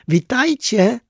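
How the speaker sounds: high, loud cheerful hail; calling out. A narrator's reading. Clown waving to the toys on the train, calling out. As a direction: call it out high to new acquaintances. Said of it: "zawołał pajacyk".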